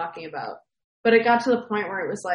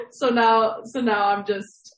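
ordinary talk

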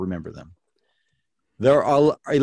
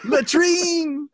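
put-on shrieked high French accent with a laugh; laughing French accent shout; mock French accent. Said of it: "the most outrageous French accent".

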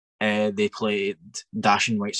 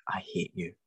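much softer hoarse whisper, slow; whispered hate; 3 semitones lower, 6 dB quieter than usual. Retold or imitated. Next morning whispering to his friend how much he hates him. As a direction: hiss it out slowly, barely voiced.

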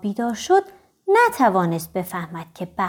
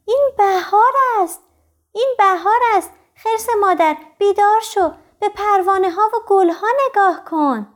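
higher-pitched little cub calling out; excited little bear's cry; 9 semitones higher, 3 dB louder than usual. A narrator's reading. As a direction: cry out to wake mother bear, pitched well up. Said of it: "خرس کوچولو فریاد زد".

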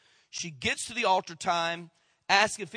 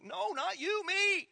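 put-on squabbling kid; 13 semitones higher, slower than usual. Kids competing for bikes.

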